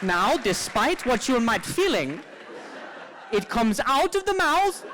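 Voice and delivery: high, slow put-on Swedish accent; lilting mock Swedish lecture voice. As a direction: Swedish accent reading laughter text to audience. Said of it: "I'm not very good with accents".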